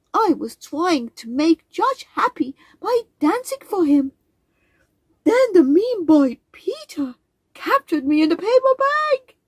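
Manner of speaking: high, squeaky squirrel voice telling his trouble; a scared small squirrel pouring out his story; frightened little squirrel. Acted.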